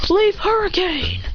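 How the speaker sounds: high, slow anime move call; attack call. Acted. Hero knocking the bullies back, high drawn-out call.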